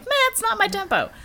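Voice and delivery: high, quick dismissive whine; petty dismissal. Retold or imitated. Reviewer quoting own objection to High Society, petty dismissal. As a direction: toss it off high and picky.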